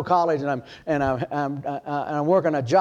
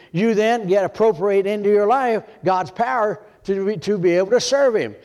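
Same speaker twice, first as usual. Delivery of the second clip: raised, insistent sermon voice; preaching; 5 semitones higher than usual. Acted. Preacher exhorting his church, raised and insistent. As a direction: press the point to the pews.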